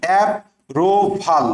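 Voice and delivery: অ্যাপ্রুভাল slowly, leaning on the double p; slow spelling pronunciation. Shown as a demonstration. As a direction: stretch অ্যাপ্রুভাল to mark the pp. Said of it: "double পি".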